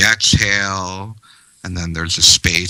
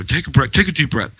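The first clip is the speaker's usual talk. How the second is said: quick, mocking rattle of advice; sarcastic; 6 semitones higher, faster than usual. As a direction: fire it off impatiently. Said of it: "they say it sarcastically".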